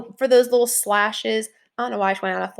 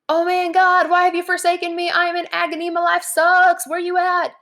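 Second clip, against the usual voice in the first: mock despairing David; 8 semitones higher than usual. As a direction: play David whining to God, high and overdone. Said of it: "David is like".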